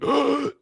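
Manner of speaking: a sharp, high mock gasp for air. Mocking a streamer who is always out of breath with a gasp. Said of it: "gasping for air the whole stream".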